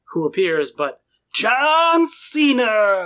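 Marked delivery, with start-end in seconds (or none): 1.4-3.1 wrestling announcer. Acted. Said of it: "announcing a wrestling entrance".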